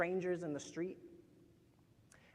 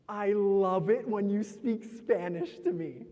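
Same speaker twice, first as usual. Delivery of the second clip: loud, drawn-out gush rising into rapid-fire pleading; gush, then beg higher with every repeat; gushing admirer; 3 semitones higher, 7 dB louder, slower than usual. Acted. Gushing admirer begs for Spanish.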